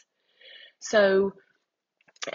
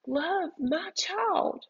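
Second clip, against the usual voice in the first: slow, high-pitched, deliberate plea; each word drawn out slowly and higher, with conviction; strong, heartfelt urging; 10 semitones higher, much slower than usual. Retold or imitated. The Lord speaking to a mother's heart, strongly and slowly. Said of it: "so strongly and said".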